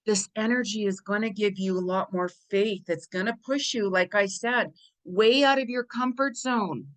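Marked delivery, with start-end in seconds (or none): none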